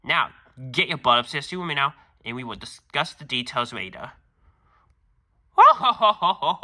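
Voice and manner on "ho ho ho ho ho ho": loud, high mock laugh; a big theatrical belly laugh; hammed-up ho-ho laugh. End of skit laugh.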